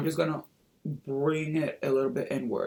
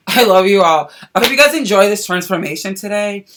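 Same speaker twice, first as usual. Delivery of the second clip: excited sign-off; 5 semitones higher, 11 dB louder than usual. Real, not acted. Enthusiastic sign-off.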